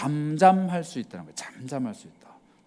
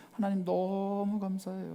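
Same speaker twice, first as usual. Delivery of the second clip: happy prayer; 4 semitones higher, 7 dB quieter than usual. Retold or imitated. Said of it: "뭐 이렇게 기도하지 마".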